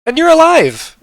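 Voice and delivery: high, loud put-on exclamation; astonished sloth. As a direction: marvel loudly, pitched right up. Sloth surprised they survived.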